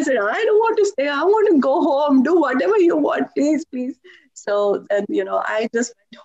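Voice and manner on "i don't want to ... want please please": high, louder tearful begging; crying plea. Retold or imitated. Homesick student begging Swami to send her home, tearful. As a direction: plead desperately, half in tears. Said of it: "like, crying".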